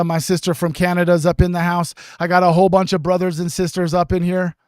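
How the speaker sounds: usual speaking voice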